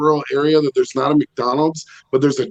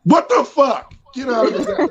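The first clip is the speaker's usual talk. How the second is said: high, puzzled neighbors echoing; the neighbors parrot it back; mock neighbors; 10 semitones higher than usual. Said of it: "they're like".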